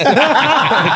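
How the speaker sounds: a loud, high laugh that breaks out; high burst of laughter. Real, not acted. Telling his friend about lust in church, loud high laugh.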